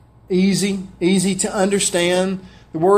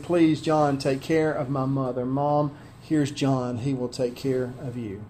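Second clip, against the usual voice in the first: soft, lower, tender entreaty; tender plea; 6 semitones lower, 9 dB quieter than usual. Acted. Dying Jesus asks John to care for his mother, tender and hushed. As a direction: ease down to a near-hush, pleading gently.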